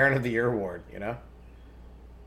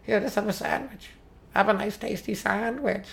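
sweet grandma; 8 semitones higher, 4 dB quieter than usual. Acted. Grandma offering sandwich sweetly.

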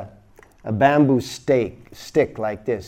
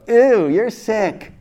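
much higher and slow, mocking dismay; mock dismayed doctor; 9 semitones higher, 5 dB louder, much slower than usual. Imagined doctor shrinking from the patient, slow high mock dismay. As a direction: drag it out like a useless doctor. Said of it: "expect the doctor to go".

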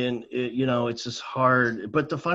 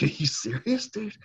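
higher, mock disbelief; incredulous retelling; 12 semitones higher than usual. Seventh grader to man at the door, incredulous. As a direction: retell it as a baffled kid. Retold disbelief at teacher's invitation.

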